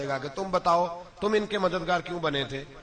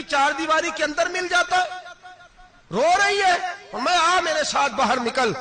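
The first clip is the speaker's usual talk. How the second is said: wrathful rebuke; 11 semitones higher than usual. Retold or imitated. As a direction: thunder at her for her ingratitude. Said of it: "حضرت عمر جلال میں آ گئے".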